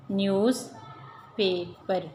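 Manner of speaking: Newspaper slowly, as news, पे, पर; split into syllables. Shown as a demonstration. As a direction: pause between the parts of newspaper. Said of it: "Aahista Aahista पढ़ेंगे".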